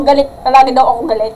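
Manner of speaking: usual speaking voice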